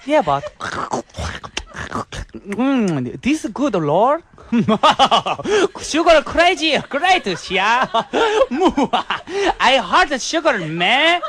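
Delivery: put-on a Singlish accent going manic with laughter. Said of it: "we're gonna do a Singlish accent".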